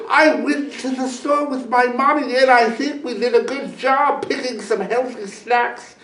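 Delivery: put-on high, proud little boy voice; proud child. A narrator's reading. Boy tells eagle proudly about his snacks. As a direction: boast about your snacks with pride. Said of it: "he said proudly".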